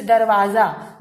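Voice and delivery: दरवाजा with a buzzing z; दरवाजा said darwaza with z; z sound. Shown as a demonstration. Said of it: "दरवाजा नहीं बोलते". (दरवाज़ा में ज़ की ध्वनि)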